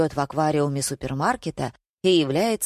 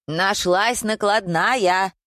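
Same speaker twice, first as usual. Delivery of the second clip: drawn-out, higher-pitched exclamation of joy; stretched, gladdened cry; delighted; 4 semitones higher, 3 dB louder, much slower than usual. A narrator's reading. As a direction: announce the happy find slowly. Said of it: "обрадовалась Вера".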